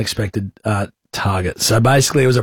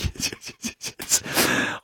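gleeful whispered yeses; 10 dB quieter, slower than usual. Retold or imitated. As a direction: hiss the yeses, barely voiced. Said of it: "I'm like".

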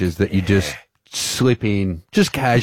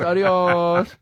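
mock Spanish goodbye; 6 semitones higher than usual. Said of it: "says adios all the time".

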